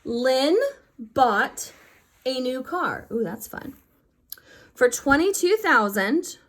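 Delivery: bright, lively read-aloud of a word problem; animated teacher reading the problem. Shown as a demonstration. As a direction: perk up to read the problem to the class. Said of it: "So it says".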